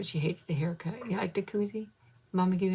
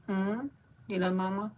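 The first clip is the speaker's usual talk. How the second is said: usual speaking voice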